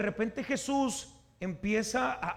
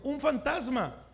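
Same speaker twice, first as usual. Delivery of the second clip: startled higher cry of alarm, drawn out; seruan ketakutan; 7 semitones higher, 4 dB louder, slower than usual. Acted. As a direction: cry out as if seeing a ghost. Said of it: "dieron voces de miedo".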